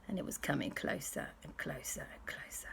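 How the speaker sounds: hushed, creeping narration; very soft, tense storyteller's whisper; suspenseful whisper. A narrator's reading. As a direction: whisper it, fading with each repeat. Suspense as dinosaur creeps nearer.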